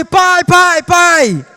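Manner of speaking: put-on high, loud urgent child calling his dad. An impatient child interrupting his father, loud high calling. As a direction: yell for Dad like an impatient kid. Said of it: "Ou você chegava assim".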